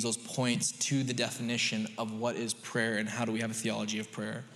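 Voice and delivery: ordinary talk, nothing marked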